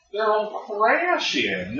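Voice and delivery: high, drawn-out country bumpkin voice. Retold or imitated. Co-host imitating the show's country bumpkin, drawled rural accent. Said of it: "he's just like".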